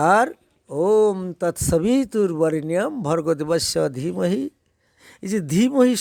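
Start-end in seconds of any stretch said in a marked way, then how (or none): 0.7-4.4 recited mantra. Shown as a demonstration.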